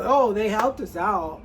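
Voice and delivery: dry mock gratitude; sarcastic. Real, not acted. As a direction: say it sarcastically, as if grateful. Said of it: "that was sarcasm".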